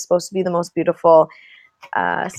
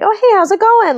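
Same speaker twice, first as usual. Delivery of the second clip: high, louder chirpy greeting; friendly, warm; 13 semitones higher, 5 dB louder than usual. Retold or imitated. Social butterfly greets plane seatmate, imitated warm and friendly. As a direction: greet a stranger with eager friendliness. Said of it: "friendly and, like, warm".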